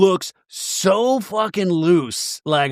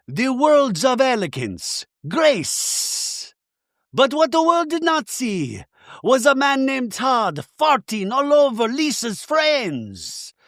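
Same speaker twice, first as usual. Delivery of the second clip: high, slow dramatic narrator; mock trailer voiceover; 9 semitones higher, slower than usual. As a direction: ham up an epic movie-trailer read. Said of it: "the voiceover continues".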